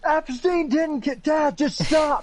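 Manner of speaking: high, strained, drawn-out yell; hammed-up high-pitched protest; mock screaming. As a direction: scream as if being shoved into a car. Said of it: "It's him screaming".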